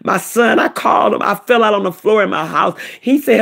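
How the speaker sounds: normal talking voice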